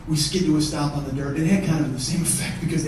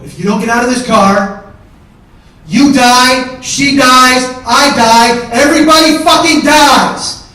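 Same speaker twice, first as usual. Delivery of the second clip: high, full-force yelled ultimatum; screamed threat; 8 semitones higher, 13 dB louder, slower than usual. Retold or imitated. Man threatening his brother in the car, screaming. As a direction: scream each threat over a revving engine. Acted ultimatum, engine revving.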